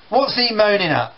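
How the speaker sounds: put-on annoyed teenager. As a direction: snap back, fed up. Brothers quarrel in acted scene.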